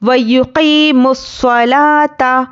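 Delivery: slow, melodic tajweed recitation; measured Quranic chant. Shown as a demonstration. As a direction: chant it slowly like a qari. Recites verse fragment.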